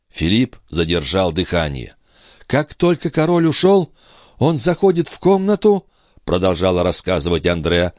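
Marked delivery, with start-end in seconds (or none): 2.5-5.9 high, tense woman's voice; entranced sister. Acted. Sister tells of the intruder entering her room, high and tense. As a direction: relive it in a raised character voice.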